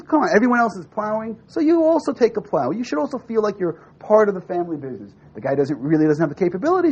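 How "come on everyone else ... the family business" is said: higher-pitched retold encouragement; acted fatherly coaxing. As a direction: urge him along like an eager parent. Father coaxes son to join.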